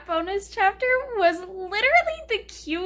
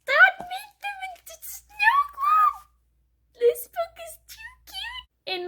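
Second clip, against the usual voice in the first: much higher, slow gushing squeal; cute squeal. Pretend. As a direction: squeak it out like a delighted fangirl. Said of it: "that means it's really cute".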